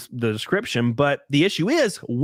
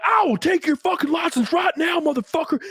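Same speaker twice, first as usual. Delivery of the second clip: much higher, furious mock shout; imitating cop's screaming; 12 semitones higher than usual. Cop screaming at a sixteen-year-old driver, furious shout. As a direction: bellow it like an enraged cop at a scared kid. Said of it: "he started screaming and spitting in my face".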